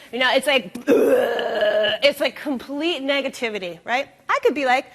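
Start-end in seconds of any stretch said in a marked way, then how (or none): 0.9-2.0 mock whiny groan. Imitating kid's groan.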